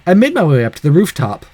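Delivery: ordinary delivery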